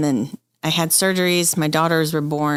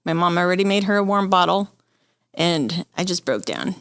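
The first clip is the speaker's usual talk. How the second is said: normal talking voice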